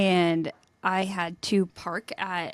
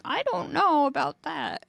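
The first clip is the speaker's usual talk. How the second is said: higher, slow, lightly voiced reluctant driver; imitated doubtful driver; 7 semitones higher, slower than usual. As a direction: imitate a driver hedging, drawn out. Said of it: "he was like".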